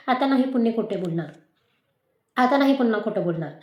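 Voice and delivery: usual speaking voice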